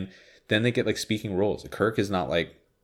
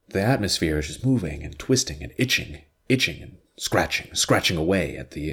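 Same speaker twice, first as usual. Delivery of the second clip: a hint of Shatner's halting drama; lightly voiced Shatner, word piled on word; Shatner impression. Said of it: "how Shatner says stuff like".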